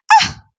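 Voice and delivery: loud, very high shocked cry; pained gasp. A narrator's reading. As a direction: cry out as if wounded. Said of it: "as if she were in severe pain".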